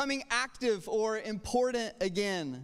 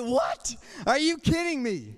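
incredulous; 2 semitones higher than usual. Retold or imitated. Rejected applicants hearing how he got the job, acted incredulity. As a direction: act out a stunned, indignant reaction. Applicants react in disbelief to explanation.

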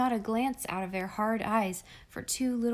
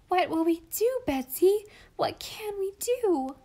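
high, louder crying wail; a small child wailing in despair; 10 semitones higher, 5 dB louder than usual. A narrator's reading. Molly wailing to Betsy, much higher and crying. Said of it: "she wailed".

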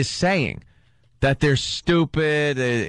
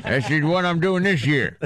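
gummy toothless mumble; 2 semitones higher than usual. Pretend. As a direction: talk with no teeth in. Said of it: "Talking without my teeth in".

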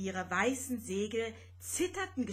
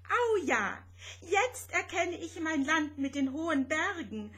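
higher, loud exultant cry; jubilant squeal; 7 semitones higher, 3 dB louder than usual. Acted. Rosetta finally sees her homeland, exultant high cry. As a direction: shout it out with delight. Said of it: "jauchzte Rosetta".

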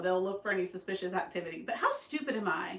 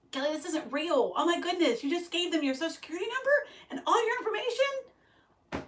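higher-pitched imitation of an appalled husband; retold disbelief; 6 semitones higher than usual. Wife mimics husband learning she gave out her information, incredulous. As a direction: voice rising into an incredulous question. Retelling husband's alarm at the scam.

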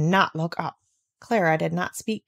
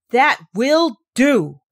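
slow, louder, choked with anger; stifled anger; 5 semitones higher, 8 dB louder, much slower than usual. Acted. Governess cutting the girl off, stifled with anger. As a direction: bite back the fury. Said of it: "voice was almost stifled with anger".

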